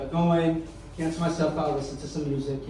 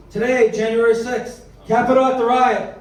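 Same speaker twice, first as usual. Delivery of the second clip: loud, higher news-headline delivery; headline read; 5 semitones higher, 6 dB louder than usual. Retold or imitated. Comic reading the morning TV headline, loud announcing. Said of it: "the headlines reads".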